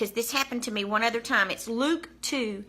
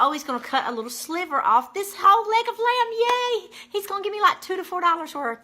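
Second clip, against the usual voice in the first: much higher, bright squeal of delight; excited hope; 10 semitones higher, 4 dB louder than usual. Retold or imitated. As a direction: relive the giddy hope of a bargain. Recalls excited hopeful thought.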